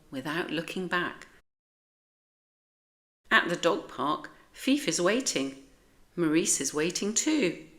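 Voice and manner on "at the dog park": ordinary delivery, nothing marked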